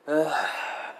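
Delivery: voiced, trailing exhale; heavy sigh. Master answering his new familiar, long heavy sigh. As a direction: let out one long sighing breath. Sighing amid an acted exchange.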